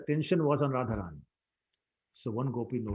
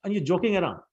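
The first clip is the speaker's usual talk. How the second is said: louder, higher furious scolding; mother yelling at Madhumangal to sit down; angry yell; 4 semitones higher, 7 dB louder than usual. Acted. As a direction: yell her frustration at the joker. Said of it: "yelled at him again".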